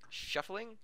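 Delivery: ordinary delivery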